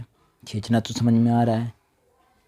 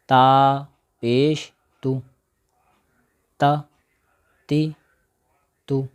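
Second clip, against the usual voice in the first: drill recitation; 3 semitones higher, 3 dB louder, much slower than usual. Shown as a demonstration. Spelling drill.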